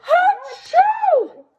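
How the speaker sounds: high loud hammed-up achoo; big sneeze. Storyteller acts out the wolf's third sneeze. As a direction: sneeze as big as you can.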